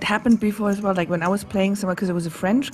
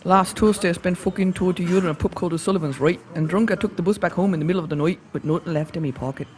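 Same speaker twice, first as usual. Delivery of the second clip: a hint of a Dublin pub story voice, Irish accent. Streamer shows her Irish accent to chat, light Dublin accent. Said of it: "let me do my Irish accent".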